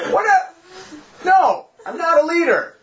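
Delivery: high-pitched, hammed-up refusal; mock protest. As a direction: refuse like a balking child.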